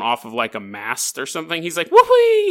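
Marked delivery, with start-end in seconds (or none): 1.9-2.5 very high, loud joyful yell; gleeful whoop. Retold or imitated. Sailor swinging off the mast like Errol Flynn, whooping. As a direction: whoop like a swashbuckler leaping.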